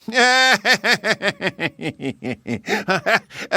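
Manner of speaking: high scornful hare laugh; mocking laugh. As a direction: laugh the idea off. Hare laughs at race challenge.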